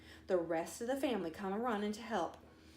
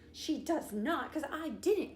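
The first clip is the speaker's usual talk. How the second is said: sniffed; 6 semitones higher than usual. A narrator's reading. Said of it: "Kelsey Ann sniffed".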